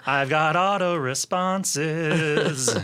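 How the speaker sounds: slow, even machine gun syllables; robot voice. Pretend. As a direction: speak like a droning robot. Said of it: "I am a robot".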